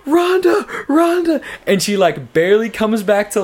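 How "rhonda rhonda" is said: high, loud cry of a name; yelling. Retold or imitated. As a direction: call out to someone passed out, imitating alarmed onlookers. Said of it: "people are yelling".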